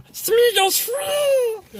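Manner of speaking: very high, drawn-out creature cry; high-pitched, stretched-out creature shriek; Smeagol voice. Acted. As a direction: squeal it slowly like a wretched little creature. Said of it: "do the Smeagol voice".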